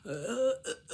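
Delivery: high, broken stuttering sound; stutter demo. Shown as a demonstration. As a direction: stutter on a repeated "uh". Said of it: "just stutter".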